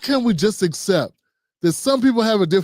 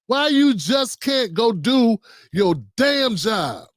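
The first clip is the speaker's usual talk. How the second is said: ordinary talk, nothing marked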